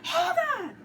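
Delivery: high sharp hold on; high commanding. Retold or imitated. Second lady halting the standoff, high call. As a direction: stop them in a high voice. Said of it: "second lady in charge is like".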